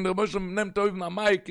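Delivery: ordinary delivery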